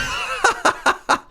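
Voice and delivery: loud, high burst of laughter; real laugh. Real, not acted. Streamer laughing at their own fireworks story, loud high laughter. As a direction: crack up in high peals.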